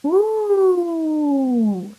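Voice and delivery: high, drawn-out ooh; ooh sound. Shown as a demonstration. Teacher showing the boy's ooh to the class, high and long. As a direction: round the lips into a high ooh. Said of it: "He's going".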